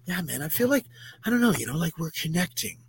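soft, dreamy imitation of a too-cozy guy; mock new-age guy. Mocking overly friendly strangers, soft dreamy imitation. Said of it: "that are all like".

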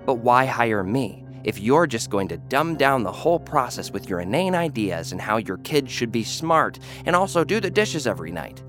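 ordinary talk, nothing marked

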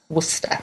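Worcester squeezed to two syllables, wuss-ter; British Worcester; Worcester said as wuster. Shown as a demonstration. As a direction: say Worcester as wooster, not wor-ces-ter. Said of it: "although it reads like Worcester".

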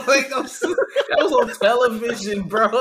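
loud, laughing high disbelief. Real, not acted. Laughing about televised blowout.

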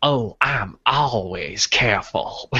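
drawn-out, creepy Creole impression; Cajun Creole accent. The rat boasting, in a weird Cajun Creole accent. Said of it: "weird Cajun, like, Creole thing".

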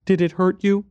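put-on high, small child voice; little boy. A narrator's reading. Boy tells stranger about lost mother, little boy's voice. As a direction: speak as a young boy, pitched high. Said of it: "Then he asked me".

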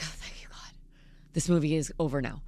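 relieved whisper. Retold or imitated. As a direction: breathe it out unvoiced in relief. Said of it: "I'm like".

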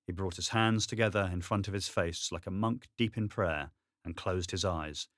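usual speaking voice

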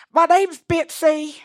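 put-on high, slow little pageant girl; small Missouri girl, sweetly entitled; Bitsy voice. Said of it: "But she goes".

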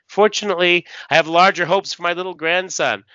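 villainous taunt. Acted. Villain father threatens the grandson to his son, acted gloating. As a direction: gloat like a comic-book villain.

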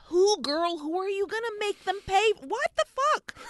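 high-pitched baffled outburst; mock-exasperated, pitch rising to a squeal; incredulous. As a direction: question it in climbing disbelief. Acted baffled reaction to film.

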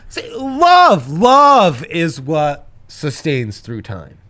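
squeaky excited outburst; high-pitched excited yelp. Real, not acted.

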